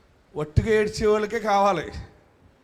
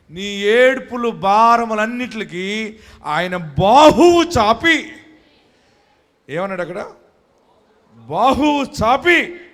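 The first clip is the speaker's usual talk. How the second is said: loud, slow, drawn-out sermon proclamation; forceful preaching; 5 semitones higher, 9 dB louder, slower than usual. Acted. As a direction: proclaim the blessing to the crowd. Preaching blessing at full force. (బిగ్గరగా, నెమ్మదిగా ప్రకటించే ప్రసంగం)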